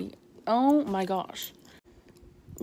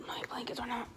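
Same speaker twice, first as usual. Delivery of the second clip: whispering; 3 semitones higher, 10 dB quieter, faster than usual. Real, not acted. Embarrassed aside about bed.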